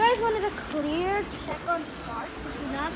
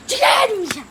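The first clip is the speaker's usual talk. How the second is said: high, sudden shout; shouted, high-pitched exclamation; excited shout; 7 semitones higher, 4 dB louder than usual. Real, not acted. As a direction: call it out loudly to the animals. Showing animals, calling out to them.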